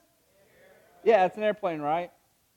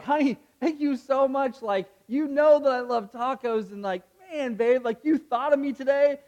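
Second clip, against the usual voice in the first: gushing thanks; 8 semitones higher, 7 dB louder than usual. Pretend. Acting delighted wife thanking husband.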